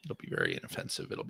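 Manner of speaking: unvoiced, fast whisper. Shown as a demonstration. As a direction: breathe it out quickly. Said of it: "repeats it whispered".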